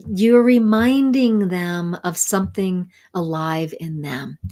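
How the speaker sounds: usual speaking voice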